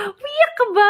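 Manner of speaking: high-pitched imitation of surprised friend; startled question. Friend asking whether the speaker cried, startled. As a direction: ask it in a high, astonished voice.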